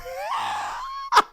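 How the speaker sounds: a sudden high squeal of laughter; high shrieking laugh. Real, not acted. Streamer cracking up at own bit, high shriek laugh. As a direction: burst into a shrill laugh.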